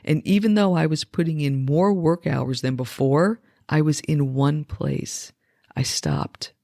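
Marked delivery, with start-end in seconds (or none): 5.8-6.5 a soft breathy whisper, barely voiced. Reflecting on lockdown, she whispers to the listener. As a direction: let the words drop to a whisper. Pausing amid a reflective essay.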